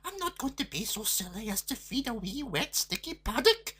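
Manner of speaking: mildly cross lassie refusing; cross girl. A narrator's reading. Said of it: "replied her daughter crossly".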